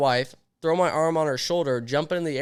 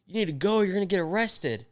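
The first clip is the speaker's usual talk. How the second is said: higher-pitched urgent plea, retold; put-on higher voice, pleading to leave; imitating wife; 7 semitones higher than usual. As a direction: imitate a wife urgently warning her husband. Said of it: "She's like".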